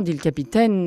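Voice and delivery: ordinary delivery, nothing marked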